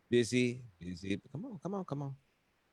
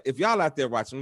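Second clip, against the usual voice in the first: normal talking voice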